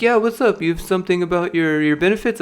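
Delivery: high, bright-eyed office voice; chirpy HR lady. Retold or imitated. HR lady greeting him before he quits, high and chirpy. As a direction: play the HR lady cheerfully clueless.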